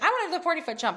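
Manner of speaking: high-pitched imitation of an eager child; eager kid. Daughter asking for the forty foot jump, imitated eagerness. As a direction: pipe up keen for the bigger challenge. Quotes her emboldened daughter.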